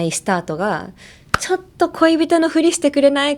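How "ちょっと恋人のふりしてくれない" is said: higher, quicker pleading story line; playful, high-pitched request to fake-date; acted fanfiction request. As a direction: ask it like a romance trope opener. Acts out typical fanfiction opening line.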